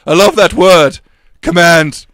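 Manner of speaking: loud, emphatic declaration; lordly relish. Acted. Lord giving the train his command code, loud relish.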